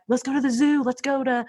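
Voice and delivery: high, eager invitation; eager mom. Retold or imitated. Mom offering her kids outings, bright and high. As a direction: pitch it up like an outing is a treat. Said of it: "Or I would, like".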